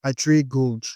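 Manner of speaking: normal talking voice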